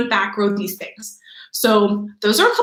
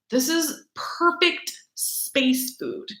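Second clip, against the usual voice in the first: hushed reveal; 4 semitones higher, 6 dB quieter, much slower than usual. Acted. Presents spinach as ideal food.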